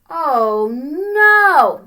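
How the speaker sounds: dismayed oh no. Acted. Dismay at no food.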